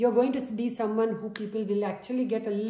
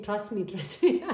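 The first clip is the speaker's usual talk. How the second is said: laughing. Real, not acted. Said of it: "I don't know why I say".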